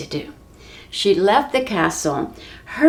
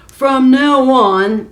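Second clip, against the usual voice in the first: loud, higher, slow royal announcement; grand proclamation; 5 semitones higher, 7 dB louder, slower than usual. Acted. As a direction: proclaim it slowly like a king. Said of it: "the giant proclaimed".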